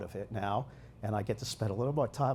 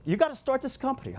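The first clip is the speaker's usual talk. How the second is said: friends urging; 13 semitones higher, faster than usual. Retold or imitated. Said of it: "They said".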